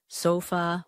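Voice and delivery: ordinary delivery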